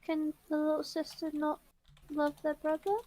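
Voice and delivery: sweet, quiet little sister; tender little sister. Acted. Little sister speaks tenderly to brother.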